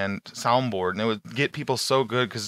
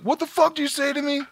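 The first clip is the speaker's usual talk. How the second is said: high-pitched outrage without extra volume; mock scream; 14 semitones higher than usual. Prank victim reacting to the soundboard, mock scream. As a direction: imitate an incredulous, worked-up victim. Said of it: "When they scream".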